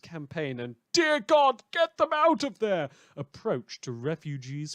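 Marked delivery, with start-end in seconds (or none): 0.9-2.9 sudden high, loud cry of alarm; panicked outburst. Acted. Radio host suddenly shouts in alarm mid-sentence.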